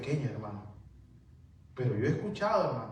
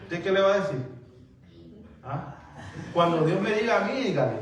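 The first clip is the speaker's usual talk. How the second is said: emphatic retort; 2 semitones higher, 3 dB louder than usual. Acted. Preacher models answering a pushy prophet, loud firm retort. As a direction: push back firmly, raising the voice.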